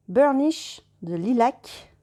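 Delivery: slow, careful English-accented reading; slow English words in a French accent. Shown as a demonstration. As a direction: read it out like a French speaker trying English, slowly. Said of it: "Pardon pour l'accent".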